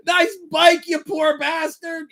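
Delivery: very high mocking heckle; mock-holler, stretching out the end; imitated window yell. Friend jeering at a cyclist, yelled high. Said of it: "Duhop would yell out the window".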